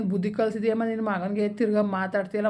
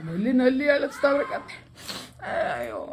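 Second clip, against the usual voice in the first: crying; 3 semitones higher than usual. Acted. Father breaking down in front of his daughter, sobbing. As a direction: laugh through tears, then sink into a sigh. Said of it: "ನೀನೇನು ಅಳ್ಬೇಡ ಸುಮ್ನಿರಪ್ಪ".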